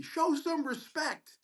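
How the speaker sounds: high, drawn-out imitated yell, not full volume; mock scream. Other resident yelling down the hallway at the student, mock scream. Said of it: "screams down the hallway".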